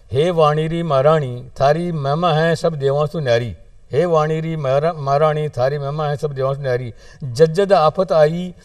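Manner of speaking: rhythmic recitation, each line declaimed and repeated; verse prayer. Acted. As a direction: plead with the goddess in measured, ringing verse. (वाणी री महाराणी सूं अरदास, लय में कविता पाठ)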